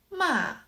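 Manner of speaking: "ma" starting high and falling sharply; fourth tone. Shown as a demonstration. Teacher shows the fourth tone, "ma" high then falling. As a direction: drop "ma" from top to bottom. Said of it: "The fourth tone".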